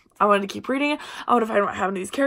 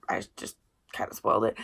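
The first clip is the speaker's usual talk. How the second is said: whispered aside; 12 semitones lower, 9 dB quieter than usual. Real, not acted. Aside after accidental spoiler.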